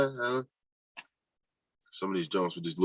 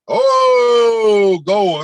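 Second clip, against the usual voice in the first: high, loud goal cry; excited goal shout; 13 semitones higher, 11 dB louder than usual. Real, not acted. Viewer cheering a goal on the stream, loud excited call. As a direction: yell it like a fan at a goal.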